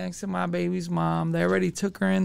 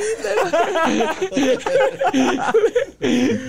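big high laugh, louder; 10 semitones higher, 5 dB louder than usual. Real, not acted. Amused by his homie's weed worry, loud laugh. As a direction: burst out laughing.

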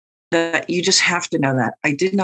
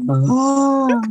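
a big, breathy, wheezing Oh, pitched high and louder; asthmatic breath; 8 semitones higher, 4 dB louder than usual. Retold or imitated. Fake tarot reader greeting her client, wheezy breathy Oh. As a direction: gasp it out like an asthmatic. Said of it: "fake tarot readers breathe like asthmatics".

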